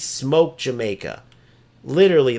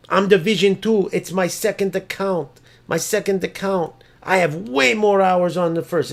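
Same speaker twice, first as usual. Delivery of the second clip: higher-pitched whiny-excuse imitation; mocking excuse; 4 semitones higher than usual. Streamer imitating rival excusing his second account, higher mocking voice. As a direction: mimic a player making excuses, pitched up.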